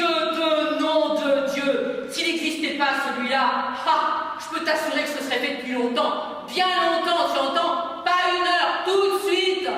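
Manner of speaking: father raging about the son; furious rant. Acted. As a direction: spit the oaths with rage. Father's rage at the child.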